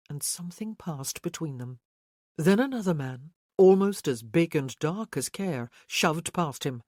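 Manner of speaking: ordinary delivery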